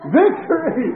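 high, loud victory cry; triumphant shout. Retold or imitated. Preacher to the healed patient, high triumphant shout. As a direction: proclaim it at full voice.

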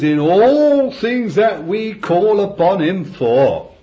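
loud, slow, ringing scripture; booming scripture reading. Acted. Preacher reading the verse aloud to the meeting, loud and slow. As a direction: proclaim the verse to the hall. Preacher reads Deuteronomy with force.

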